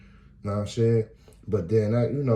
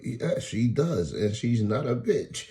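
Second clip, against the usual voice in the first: slow correction; 5 semitones higher, 3 dB louder, slower than usual. Retold or imitated. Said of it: "I was like".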